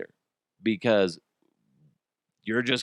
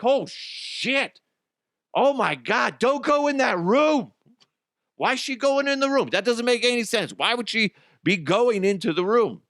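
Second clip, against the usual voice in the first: agitated horror-movie heckling; 11 semitones higher than usual. Retold or imitated. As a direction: yell at the screen in disbelief. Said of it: "be like".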